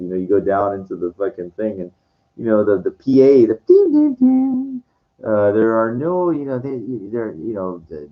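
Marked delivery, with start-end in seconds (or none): 3.5-4.8 sung high ding ding chime; chime imitation.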